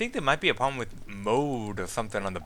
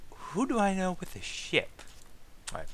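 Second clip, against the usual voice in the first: ordinary talk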